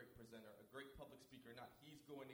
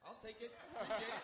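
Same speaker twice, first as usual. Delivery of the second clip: laughing acceptance; 9 semitones higher, 10 dB louder than usual. Retold or imitated.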